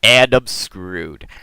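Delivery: exasperated Illidan. Retold or imitated. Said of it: "He's gonna be like".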